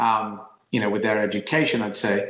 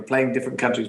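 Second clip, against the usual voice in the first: ordinary talk, nothing marked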